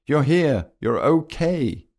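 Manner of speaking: high-pitched, excited relief; delighted outburst; happy relief. A narrator's reading. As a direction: exclaim that a friend is safe, voice lifted. Cheering that friend is safe.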